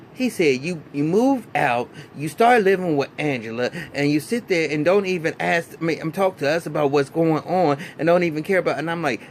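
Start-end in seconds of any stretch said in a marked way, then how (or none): 0.6-8.8 a hint of a stern father raising his voice; retold scolding dad. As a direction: imitate the father laying into his son. Father scolding son over moving out.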